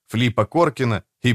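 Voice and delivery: ordinary delivery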